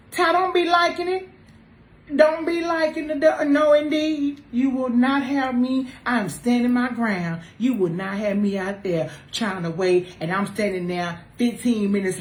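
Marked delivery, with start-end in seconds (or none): none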